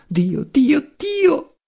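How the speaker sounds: high, rising anguished cry; anguished moaning. A narrator's reading. Adriana hides her face in her hands, anguished moaning. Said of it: "gemendo straziata".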